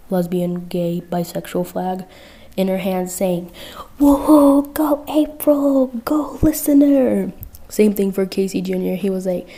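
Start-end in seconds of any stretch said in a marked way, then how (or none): 4.0-7.3 high, loud, slow whooping cheer; a loud, high, drawn-out cheer; excited cheering. Acted. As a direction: cheer them on like a fan in the stands. Cassandra cheering with flag.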